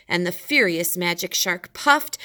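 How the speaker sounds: plain reading voice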